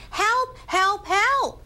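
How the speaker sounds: restrained, high imitation of screaming for help; imitated cry for help. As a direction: mimic a youngster's high scream at talking volume. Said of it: "high pitch of a younger person screaming".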